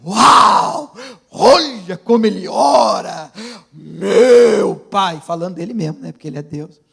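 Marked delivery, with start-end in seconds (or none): 0.0-5.2 booming, drawn-out mock wonder; mock amazed God. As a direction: play an awestruck God, wildly over the top. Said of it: "como se Deus no céu dissesse".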